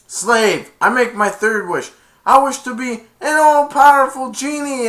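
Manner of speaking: high, loud imperious wish; commanding villain. Retold or imitated. Jafar demanding his third wish from the genie, loud high command. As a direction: proclaim it like a tyrant.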